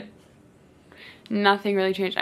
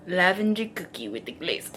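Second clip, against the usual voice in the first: low, soft imitation of a food reviewer; mock the man savouring each dessert; 4 semitones lower, 6 dB quieter than usual. Man panning his phone over desserts, mock low narration. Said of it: "he's, like, panting".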